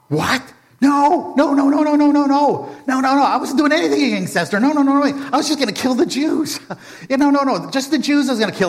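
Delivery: high, loud frantic denials; panicked Haman. Acted. Haman caught out before the king, panicked and high. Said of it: "Haman is shocked".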